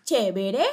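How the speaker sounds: "chévere" sin tilde, acento fuera de la primera sílaba; leído como está escrito. Retold or imitated. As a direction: sube al final de "chévere", como "cheveré". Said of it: "lo estoy leyendo como me escribe". ("chévere" mal acentuado a propósito, cheveré)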